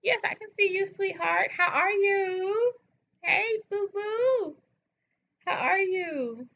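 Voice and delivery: high, drawn-out sing-song hello; sweet high greeting. Real, not acted. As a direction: coo a greeting to a favourite viewer, slowly.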